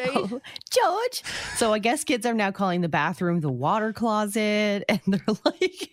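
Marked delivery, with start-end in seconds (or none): 0.0-1.2 high mock-British exclamation; playful British imitation, pitched way up; British accent. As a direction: coo it like a British cartoon character. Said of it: "in British accents".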